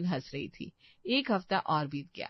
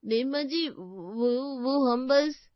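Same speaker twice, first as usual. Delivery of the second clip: hesitant, nervous; 6 semitones higher, 5 dB louder, slower than usual. Acted. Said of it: "हिम्मत करके कहा".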